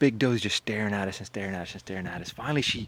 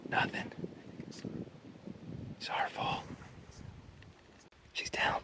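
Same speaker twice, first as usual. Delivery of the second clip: whispering; 11 dB quieter, much slower than usual. Real, not acted. Whispering right after the shot.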